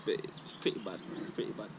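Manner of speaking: drops to a near-whisper; trailing off. Real, not acted. Rating the weed to viewers, voice sinking to a breathy murmur. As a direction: let it fade out under his breath.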